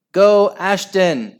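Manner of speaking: high, loud cheer; shriek. Acted. Henry cheering at the radio draw, shrieking. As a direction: cheer for your team. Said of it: "shrieked Horrid Henry".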